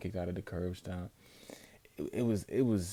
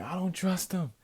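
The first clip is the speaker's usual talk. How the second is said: high, building louder shout; retelling screaming on stream, getting louder; 12 semitones higher, 7 dB louder than usual. Retold or imitated. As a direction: yell your suspicion at the screen. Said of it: "I was screaming".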